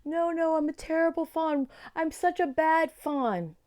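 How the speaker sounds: a fawn pleading, near tears; crying. A narrator's reading. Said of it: "he started crying and saying".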